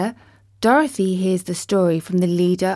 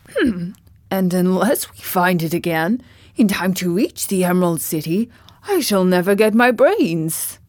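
lightly voiced Scarecrow, fretting; a hint of a worried straw man; grumbling Scarecrow. A narrator's reading. Scarecrow fretting about his brains, mild grumble. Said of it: "The Scarecrow began to grumble".

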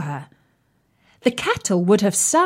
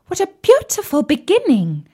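high, louder delighted plates; 8 semitones higher, 4 dB louder than usual. Acted. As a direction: gush with delight.

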